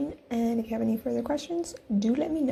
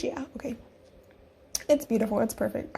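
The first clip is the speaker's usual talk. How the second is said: ordinary talk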